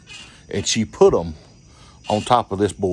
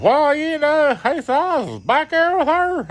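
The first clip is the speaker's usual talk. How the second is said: high put-on friend voice; buddy's voice. Friend explaining where he had been, high mock voice. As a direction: play your sheepish buddy, pitched high.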